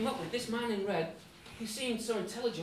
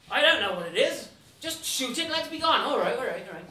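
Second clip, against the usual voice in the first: loud, exasperated urging; acted impatience; 3 semitones higher, 7 dB louder than usual. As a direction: push him to get it over with. Impatient devil urges shot.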